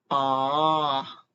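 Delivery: AH drawn out, dipping then rising; long curved AH that falls and climbs back; third tone. Shown as a demonstration. Teacher showing beginners the third tone, AH dipping. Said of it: "The third tone is fall and rise again".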